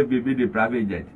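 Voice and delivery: low, quiet mock quote. Panelist mimics what the man should have said, low and quiet. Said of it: "You say".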